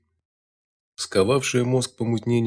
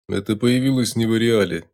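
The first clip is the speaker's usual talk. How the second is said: ordinary delivery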